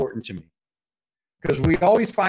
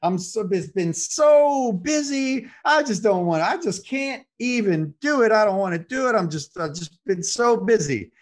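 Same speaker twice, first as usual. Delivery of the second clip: high, slow, whiny mock exhaustion; exhausted husband; 7 semitones higher, slower than usual. Husband whines to wife he's too tired, slow and high. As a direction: drag it out like a worn-out husband. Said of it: "And he goes".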